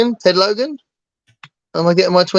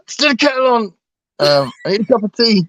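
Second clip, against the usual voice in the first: higher, mock-fraught demand; bad-day demand; 7 semitones higher than usual. Brit on a bad day needing tea, mock fraught. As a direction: play someone desperate after a bad day. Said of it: "if you've had a bad day, you might say it like that".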